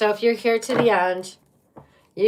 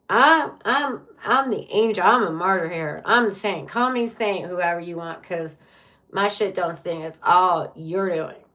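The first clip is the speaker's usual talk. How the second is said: mock a blameless saint protesting; self-righteous martyr; 5 dB louder than usual. Reader imitates a partner refusing accountability, self-righteous. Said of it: "This is how this person acts".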